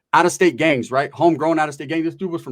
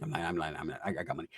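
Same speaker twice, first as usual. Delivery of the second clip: whispered aside, much softer; hushed aside; 10 semitones lower, 15 dB quieter, faster than usual. Retold or imitated. He tells the girl privately that he's lying, hushed. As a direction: lean in and confess quietly.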